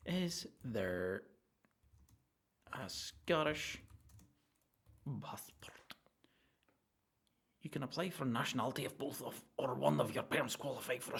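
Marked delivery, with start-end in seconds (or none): 5.2-5.7 unvoiced, soft whisper. Looking it up, whispers the word. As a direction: breathe the word secretly.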